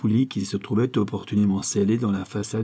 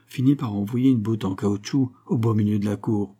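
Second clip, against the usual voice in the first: ordinary delivery, nothing marked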